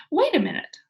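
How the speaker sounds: high, loud call; girl calling the policemen back, loud; cried out. A narrator's reading. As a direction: call after them to stop. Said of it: "she cried".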